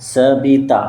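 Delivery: সবিতা in three even beats, স-বি-তা; split into syllables. Shown as a demonstration. Teacher introducing a name to spell, সবিতা broken as স, বি, তা. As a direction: sound out সবিতা one syllable at a time. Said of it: "এখানে তিনটে syllable আছে".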